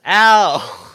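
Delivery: a loud, high cartoonish yelp of pain; mock yelp. Host imitating Lucifer shot in the head, loud yelp. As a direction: yelp it like the devil just got shot. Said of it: "And then he goes".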